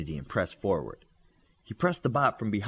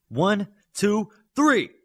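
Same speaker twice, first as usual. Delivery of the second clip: shouted countdown; 11 semitones higher, 7 dB louder, much slower than usual. A narrator's reading. Hollow counting down to attack.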